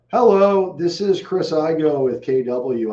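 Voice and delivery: high, loud sales hello; confident sales hello; phone greeting voice. Real, not acted. Cold call greeting.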